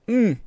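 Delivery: high muffled squeal through a kiss. Acted. Narrator muffled under his hard kiss, high squeal.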